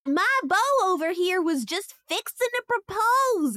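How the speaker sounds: high Southern belle voice; hammy stage actor. Acted. V in a put-on voice.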